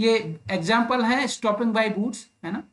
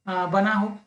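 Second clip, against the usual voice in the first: ordinary talk, nothing marked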